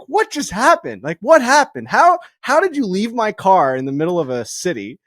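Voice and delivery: high, loud flabbergasted questions; baffled disbelief. Retold or imitated. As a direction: pile up the questions in shock. Mike baffled by broken club.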